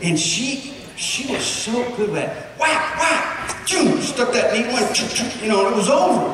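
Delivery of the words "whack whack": imitated cuts.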